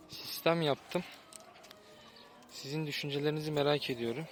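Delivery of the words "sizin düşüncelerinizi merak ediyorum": tired, hoarse voice; hoarse from fasting and fatigue. Real, not acted. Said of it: "sesim boğuk gelebilir".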